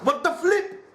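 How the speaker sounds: surprised. Real, not acted. Startled by a sudden sneeze.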